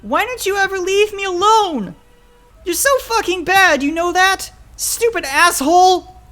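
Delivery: very high, loud outburst; furious screaming. Acted. Child lashing out at threatening father, furious scream. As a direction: scream insults at full pitch.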